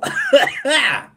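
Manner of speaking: high, loud burst of laughter. Real, not acted. Streamer cracking up at chat, loud laugh. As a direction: crack up at a comment.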